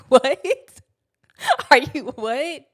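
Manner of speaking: loud incredulous cry through a laugh; laughing disbelief. Retold or imitated. Daughter reacting to mom's relief, loud laughing disbelief. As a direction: blurt it out, laughing in shock.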